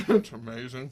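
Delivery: talking through cupcake; mouth full. Real, not acted. Host asked about the cupcake mid-bite, mouth full. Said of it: "Apparently you can't talk right now".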